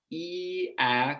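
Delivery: "ee" then "ah", held vowels; drawn-out sample vowels, jaw dropping; vowel demo. Shown as a demonstration. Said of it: "go from the vowel in heat to hat".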